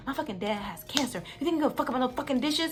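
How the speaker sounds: high, rising furious yell; reliving the screaming match, pitch climbing; retold screaming. Yelling at roommate over dishes, high furious scream. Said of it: "literally screaming at the top of my lungs".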